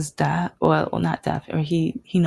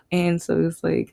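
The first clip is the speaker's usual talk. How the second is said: usual speaking voice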